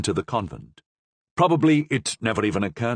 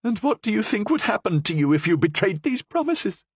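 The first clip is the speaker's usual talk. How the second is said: high, bitter prisoner voice; 12 semitones higher than usual. A narrator's reading. Prisoner refusing the priest, high and bitter.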